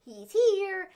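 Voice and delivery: imitating an unsettling warning; creepy announcement. Host mimics Alexa announcing the Fiend, eerie. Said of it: "Alexa came out and was like".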